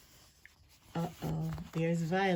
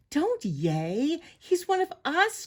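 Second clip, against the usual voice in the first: protest, jumping high at the end; mild indignant cry; 8 semitones higher than usual. A narrator's reading. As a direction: scold the cheering crowd, voice rising. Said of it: "cried Yip".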